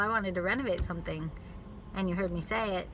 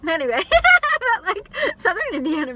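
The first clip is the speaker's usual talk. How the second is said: loud laughing, high; giggly loud aside; 12 semitones higher, 13 dB louder than usual. Real, not acted. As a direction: laugh the words out. Laughing after etymology joke.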